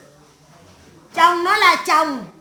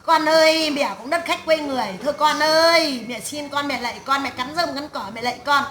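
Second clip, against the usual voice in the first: pleading; 2 semitones lower than usual. Acted. Modelling a mother begging her child to stay, pleading. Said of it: "mày này về mày bảo này chứ".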